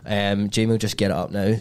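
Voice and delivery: ordinary talk, nothing marked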